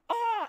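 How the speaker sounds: one short high loud yelp; mock pained yell. Retelling Ace Ventura arrow scene, a high loud yelp. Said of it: "He goes".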